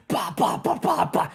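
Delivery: mock gunfire bursts rising high at the end; Ben Stiller gunfire. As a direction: imitate a frantic movie soldier firing away. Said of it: "Tropic Thunder when Ben Stiller's just like".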